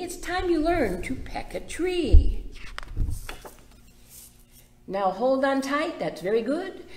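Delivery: lively, sing-song picture book reading; reading to children. Acted. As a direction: read it bright for little ones. Reads picture book aloud to kids.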